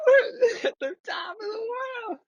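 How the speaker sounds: very high, squeaky mock squeal; giddy tripping voice. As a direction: squeak it out in giggly, tripping bliss. Repeats tripping line after laughing.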